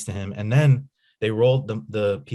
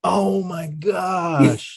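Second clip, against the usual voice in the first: high, slow and drawn out; breathless real amazement; stunned gasp; 9 semitones higher, 5 dB louder, much slower than usual. Real, not acted. Seeing the photo, stunned.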